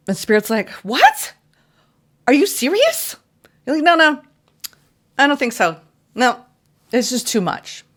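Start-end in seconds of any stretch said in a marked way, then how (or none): 0.8-3.2 squeaky high, amazed disbelief; incredulous Spirit. Acted. 3.8-7.8 reluctant refusal. Acted. Voicing reluctant self.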